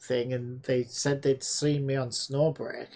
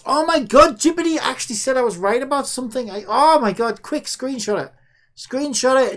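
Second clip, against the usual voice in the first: high, loud gleeful outburst; thrilled she finally won one; excited disbelief; 7 semitones higher, 6 dB louder than usual. Real, not acted. AI admits she was right.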